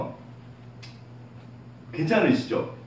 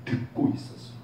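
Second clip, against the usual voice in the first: God answering a prayer in a gentle whisper; 8 semitones lower, 10 dB quieter than usual. Pretend. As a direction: breathe it like a loving father close by. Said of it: "하나님이 응답하세요".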